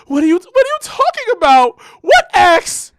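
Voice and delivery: high, loud girlfriend imitation. Imitating girlfriend denying the ex, high and loud. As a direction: squeal it like she's denying everything. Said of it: "She goes".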